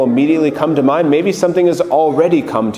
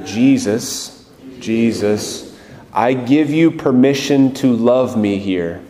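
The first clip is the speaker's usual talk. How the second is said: guided prayer; 3 semitones lower than usual. Acted. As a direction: lead the room gently into prayer.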